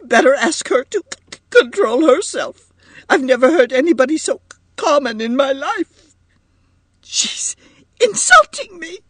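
high-pitched tearful stammer; brokenly. A narrator's reading. Edith complains about Missus Markey through tears, brokenly. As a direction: catch on hard words, voice breaking upward. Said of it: "exclaimed Edith brokenly".

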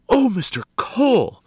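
high, loud cry of shock; shocked gasp. A narrator's reading. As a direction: gasp it as though seeing a ghost. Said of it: "gasped the lady, as though seeing a ghost".

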